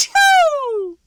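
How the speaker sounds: huge high theatrical achoo; loud fake sneeze. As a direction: blast out an enormous sneeze. Wolf sneezes.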